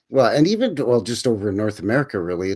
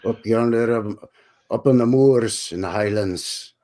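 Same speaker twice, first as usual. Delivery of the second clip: a lightly done bad Scottish brogue; terrible Scottish accent. Host shows off a bad Scottish accent to his co-host. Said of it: "terrible Scottish accent".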